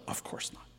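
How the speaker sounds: soft, breathy self-reassurance; whispered dismissal. Acted. Cantor dismissing the rumor to himself, whispered. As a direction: whisper it to settle the doubt.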